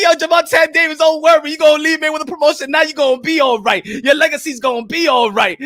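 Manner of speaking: ordinary talk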